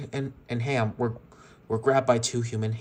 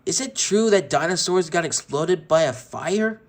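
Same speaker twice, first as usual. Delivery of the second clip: louder, higher sneering question; mocking bully; 5 semitones higher, 6 dB louder than usual. Sid taunting Rex with a lit match, louder sneer. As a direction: tease him cruelly with fake curiosity.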